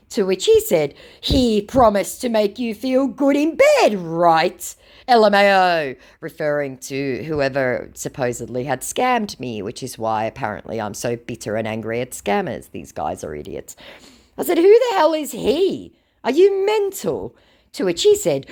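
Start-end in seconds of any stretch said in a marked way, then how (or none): 1.2-6.0 high, loud mimicking jeer; mock scammer taunt. 14.6-17.4 high, loud, incredulous challenge; mock indignant retort. Own indignant reply in text quarrel.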